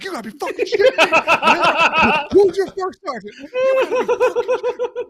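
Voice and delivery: loud, high, slow outraged yelling; outraged shout. Retold or imitated. An offended first sergeant yelling at the gate guard, outraged. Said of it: "He's like".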